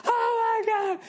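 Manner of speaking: very high squealed fan cry; squealing nerd. Retold or imitated. Nerds watching Steve Jobs, mock high squeal. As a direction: shriek it like a thrilled fanboy. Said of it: "all the nerds are like".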